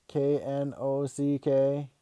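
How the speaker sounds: slow, letter-by-letter spelling; spelled out. Acted. Kid spelling out a word to his barging dad, slowly and pointedly.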